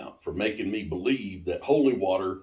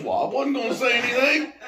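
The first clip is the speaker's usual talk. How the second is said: high, quick sheepish imitation of Patty; imitating Patty; 4 dB louder, faster than usual. [name] quotes Patty's sheepish excuse, high and quick. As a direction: chirp it innocently.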